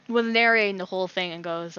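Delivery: normal talking voice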